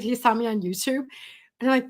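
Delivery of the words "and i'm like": normal talking voice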